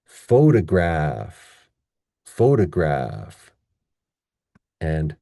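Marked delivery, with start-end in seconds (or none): 0.0-3.5 "photograph" slow, with long strong PHO; stress on first syllable. Shown as a demonstration. Teacher contrasting two forms, slow PHO-stressed "photograph". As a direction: draw out PHO, lighter graph in "photograph". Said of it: "isn't that totally different than".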